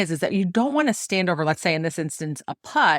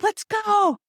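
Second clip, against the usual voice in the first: much higher, eager rallying cry. Retold or imitated. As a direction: urge everyone to go like a cheerleader. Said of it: "I'm like".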